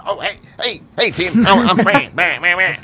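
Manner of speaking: high, goofy mock greeting from the boss; imitating Fran. Boss Fran greeting the new intern, goofy high mock voice. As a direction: ham up the awkward boss saying hi. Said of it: "Fran's all like".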